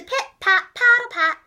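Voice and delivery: march the words like waddling ducks; duck footsteps chant. A narrator's reading. Narrator imitating the ducks' marching feet, loud and bouncy.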